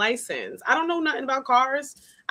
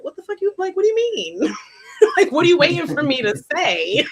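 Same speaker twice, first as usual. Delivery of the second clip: retold exasperation, pitched way up and laughing; high mock-indignant questioning, getting louder; exasperated disbelief; 7 semitones higher, 5 dB louder than usual. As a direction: protest incredulously with laughter breaking in. Acts out exasperated thoughts at ex.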